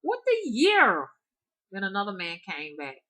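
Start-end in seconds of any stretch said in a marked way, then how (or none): 0.0-1.1 very high, loud and slow; baffled. Retold or imitated. Teller asks a strange woman, stunned and slow. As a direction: stretch it out in disbelief.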